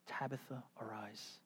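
soft, half-whispered wake-up; tender hush. Retold or imitated. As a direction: coax a sleeping child awake gently. Peter quietly raising Tabitha.

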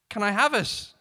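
limp asking. A narrator's reading. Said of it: "he limply asked".

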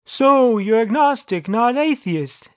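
lightly voiced deity, slowing at the end; a hint of the booming voice from above; God. A narrator's reading. God questioning the narrator, lightly booming voice. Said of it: "asked God".

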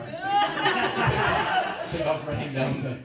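loud laughing burst as he tells of running off; laughing loudly. Real, not acted. Laughing while retelling the escape.

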